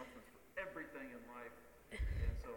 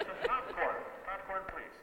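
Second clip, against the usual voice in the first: louder, amplified request; megaphone voice; 4 semitones higher, 8 dB louder, slower than usual. Retold or imitated. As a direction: call it through a megaphone. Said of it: "megaphones to communicate with your spouse".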